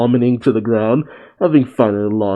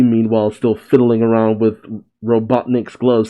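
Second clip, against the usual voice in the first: ordinary delivery, nothing marked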